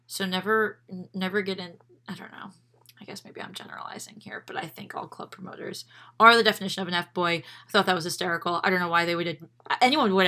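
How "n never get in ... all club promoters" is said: hushed, breathy whisper. Real, not acted. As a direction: whisper it like a secret. Host whispers a confession.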